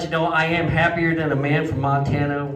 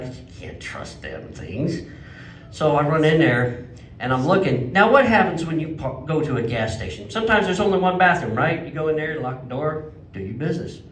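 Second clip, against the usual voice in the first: normal talking voice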